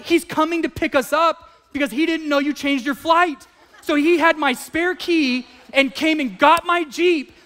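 high, loud explaining cry; wife explaining the brother-in-law took the Jeep; wife's exasperated shout. Retold or imitated. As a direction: spell it out to him, half laughing. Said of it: "She's like".

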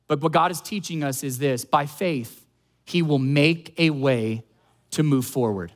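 ordinary talk, nothing marked

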